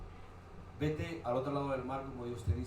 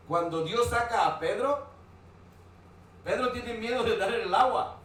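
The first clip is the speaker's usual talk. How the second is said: louder, higher preaching emphasis; raised preaching voice; 7 semitones higher, 7 dB louder than usual. Acted. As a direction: drive the point home to the congregation.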